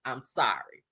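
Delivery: a soft whisper; whispered sorry. Retold or imitated. Grandma whispering her apology before the threat. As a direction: breathe the apology under her breath. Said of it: "She said".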